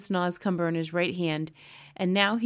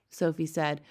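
ordinary delivery, nothing marked

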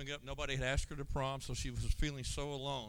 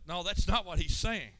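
louder, higher retold correction; setting them straight; 5 semitones higher, 6 dB louder than usual. Roxanne straightening out the kids in the van, emphatic correction. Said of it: "started straightening all the kids out".

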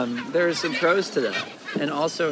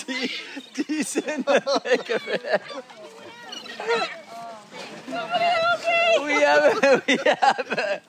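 laughing; 8 semitones higher than usual. Real, not acted. Laughing while friend gags.

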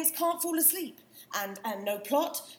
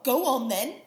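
daring challenge; 9 dB louder than usual. Acted. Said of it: "we're arguing because it makes things more interesting".

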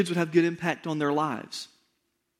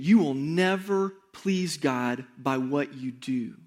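slow, deliberate, every word weighed; slow emphatic repeat; 4 semitones lower, slower than usual. Shown as a demonstration. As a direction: let it sink in slowly. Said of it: "I'm gonna say that again to you 'cause I want it to sink in".

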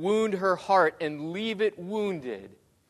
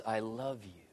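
soft, low, almost breathed; hushed "I love you"; 5 semitones lower, 10 dB quieter than usual. Acted. God standing in the street telling his bride, hushed and low. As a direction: say it quietly despite the word yells. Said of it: "he yells".

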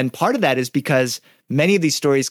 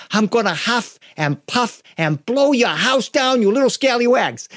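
wolf as a storyteller's uncle; higher, slow, drawn-out playful threat; Uncle Mike; 8 semitones higher than usual. Shown as a demonstration. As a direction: menace the little ones in a put-on uncle voice. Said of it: "sometimes he's my Uncle Mike".

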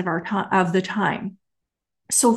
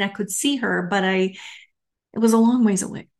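usual speaking voice

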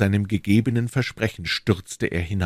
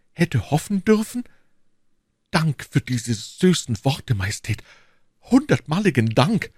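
hopeful duke, rising at the questions; ardent suitor; 9 semitones higher than usual. Acted. Duke seizing on the queen's hint of love, ardent. Buckingham hopes for her love.

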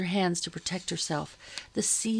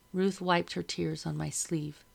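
usual speaking voice

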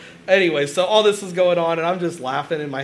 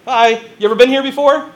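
higher, louder imitated waiter spiel; chirpy waiter greeting; 7 semitones higher, 9 dB louder than usual. Waiter greeting customers at Skyline, imitated perky welcome. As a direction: greet the table like an eager server.

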